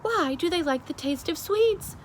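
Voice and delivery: high, puzzled question; baffled king. Acted. The King of Sweden asks, high and baffled. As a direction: sound utterly baffled.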